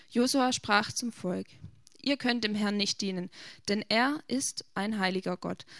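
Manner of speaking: normal talking voice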